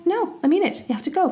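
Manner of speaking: high-pitched, mildly acted insistence; insistent wife. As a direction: lightly play a wife who won't budge. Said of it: "She's like".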